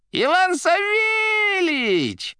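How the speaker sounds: very high, loud, drawn-out delighted cry; joyful cry. A narrator's reading. The receiver greeting the administrator, crying out joyfully. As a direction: shriek the greeting with delight, stretched out. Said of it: "радостно вскричала трубка".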